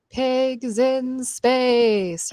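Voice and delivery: drawn-out, echoing announcer voice on pigs in space. Retold or imitated. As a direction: stretch it slow like a TV announcer. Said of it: "I wrote it that way because of".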